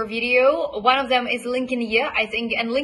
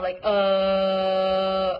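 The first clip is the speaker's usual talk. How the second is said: drawn-out, hesitant "uh" thinking sound; 3 semitones lower than usual. Shown as a demonstration. Coach showing the hesitation sound for the vowel in money. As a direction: hold the "uh" like you're pondering. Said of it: "how you would make this thinking sound".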